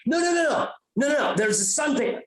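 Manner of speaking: high, rapid-fire acted panic; hammed-up frantic protest; panicked insisting. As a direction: insist frantically that the danger is real. Retold panicked reply to the mother.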